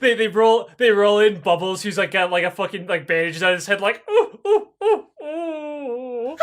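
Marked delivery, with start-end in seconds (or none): 4.1-6.4 high mock monkey ooh-ooh with a laugh; monkey hoots. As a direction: hoot like a hurt monkey. Imitating crippled monkey sounds.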